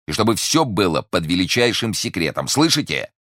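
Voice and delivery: shouted order. A narrator's reading. Commissioner demands secrecy from the jailers, shouting. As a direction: drive the order home, rising sharply at the end. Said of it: "крикнул комиссар тюремщикам".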